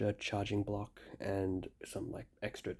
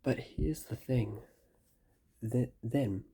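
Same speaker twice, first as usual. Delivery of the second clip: ordinary talk, nothing marked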